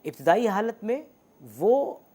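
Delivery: usual speaking voice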